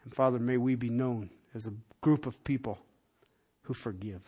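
soft, low, hushed prayer voice; gentle pastor's prayer; closing prayer. Acted. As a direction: pray quietly over the congregation.

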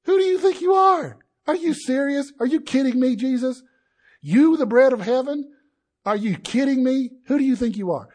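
hammed-up indignant crowd jeering at Jesus; mock outrage. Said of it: "ancient Palestinian way of".

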